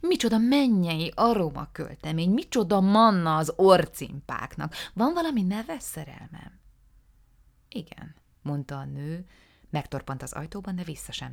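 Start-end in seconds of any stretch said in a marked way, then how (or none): 0.0-6.6 loud gushing rapture over a perfume; gushing exclamation. Acted. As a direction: exclaim over the scent, louder and louder. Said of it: "kiáltott fel". (a férj hangosan áradozik felesége parfümjéről)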